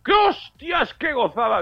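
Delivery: excited yelp. Retold or imitated. Pensioner retelling his delight at the bank app, gleeful high yelp. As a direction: squeal with delight at the payout. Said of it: "Y digo".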